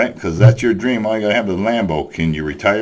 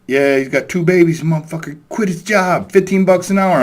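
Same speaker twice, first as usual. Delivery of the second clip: indignant coworker rant; 8 semitones higher than usual. Retold or imitated. Coworker ranting about brother.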